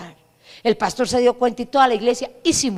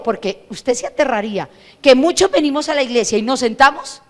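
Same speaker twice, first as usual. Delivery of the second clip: ordinary delivery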